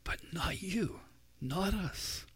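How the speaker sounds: high, soft and hushed; soft emphatic contrast. Real, not acted. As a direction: drop to a tender hush. Preacher contrasts believers with world.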